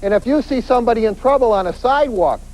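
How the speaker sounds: ordinary talk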